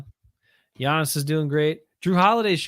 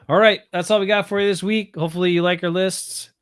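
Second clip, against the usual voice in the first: ordinary talk, nothing marked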